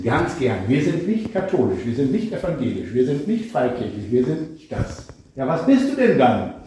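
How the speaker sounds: ordinary delivery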